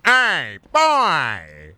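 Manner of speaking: big drawn-out holler of a game character; loud, high-pitched mock shout falling away; imitated yell. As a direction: yell it out, stretching each word. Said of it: "the one guy who would yell".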